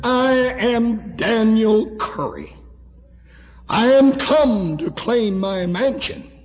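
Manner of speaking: high, loud, ringing proclamation; bold declaration. Retold or imitated. Preacher voicing Daniel Curry at heaven's gate, bold declaration.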